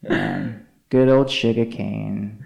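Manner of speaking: ordinary talk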